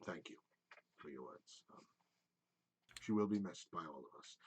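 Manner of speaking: very low, soft, subdued thanks; grieving elf. Acted. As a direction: let the grief show quietly. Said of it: "he goes".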